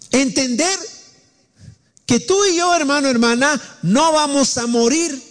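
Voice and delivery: high, impassioned preacher's cry held through every phrase; fervent preaching. Acted. Preacher urging the congregation through hardship, impassioned and high. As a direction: preach it up high with rising fervor. Preacher rises to full fervor.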